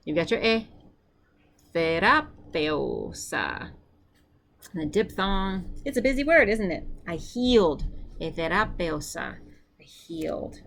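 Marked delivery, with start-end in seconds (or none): 1.7-3.7 terapeusa drawn out slowly, leaning on the accent; slow accented drill. Shown as a demonstration. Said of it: "use that accent to help you pull". 9.8-10.6 soft unvoiced gloss; whispered aside. Real, not acted. Whispered aside after the Greek.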